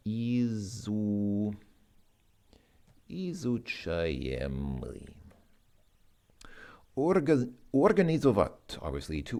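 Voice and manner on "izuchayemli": izuchayemli said slowly, syllable by syllable; slow careful pronunciation. Shown as a demonstration. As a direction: sound out izuchayemli for the class. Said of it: "What is being learned or studied is".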